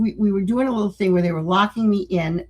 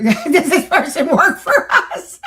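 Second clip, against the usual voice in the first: laughing question; 8 semitones higher, 6 dB louder, slower than usual. Real, not acted.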